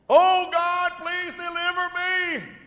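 high, mock whining plea; pleading sinner. Man crying to God for rescue, mock plea. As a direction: beg in a put-on voice.

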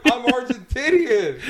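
high, very loud, stretched-out declaration; retold loud protest. Bartender insisting on his origins, retold loud protest. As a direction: re-enact insisting, played for laughs.

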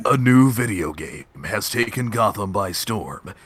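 low, dramatic trailer announcer; movie trailer voice. Acted. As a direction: narrate it like an epic movie trailer. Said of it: "movie trailer voice".

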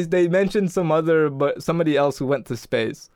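normal talking voice

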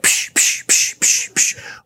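rapid voiceless "psh" bursts; sharp repeated smacks made with the mouth; imitated slapping noise. God's word as a hand slapping the reader, mock smacking noises. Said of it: "a hand that comes out and is like".

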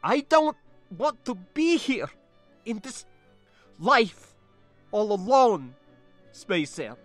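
put-on high, halting, accented plea; distressed plea. Niece dreading life alone without spacer, distressed. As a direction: plead brokenly with pauses between phrases.

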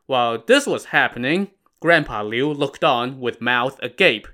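ordinary delivery

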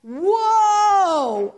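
loud high amazed whoa; crowd gasp of wonder. Retold or imitated. Congregation seeing a healing, loud amazed whoa. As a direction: shout it like a whole church amazed. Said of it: "everybody went".

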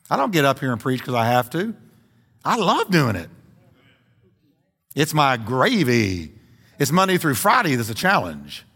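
usual speaking voice

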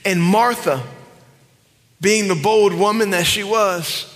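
ordinary delivery

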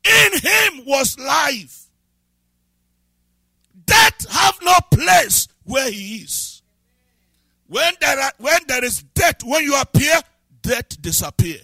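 loud, high and slow, shouting each phrase; full-force preaching. Acted. Preacher proclaiming life over death to the church, shouting. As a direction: thunder it out over the congregation.

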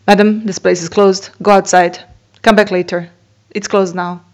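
loud, stern scolding of an intruder; scolding lady. Retold or imitated. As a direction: tell her off loudly, no patience. Lady scolding her at temple.

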